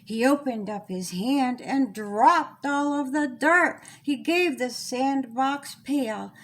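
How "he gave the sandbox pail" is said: plain reading voice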